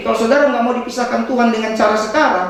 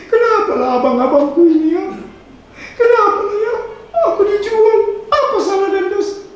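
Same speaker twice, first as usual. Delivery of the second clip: hammed-up self-pity; mock weeping; 11 semitones higher than usual. Joseph lamenting his brothers who sold him, in mock tears. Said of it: "Yusuf kalau dia nangis".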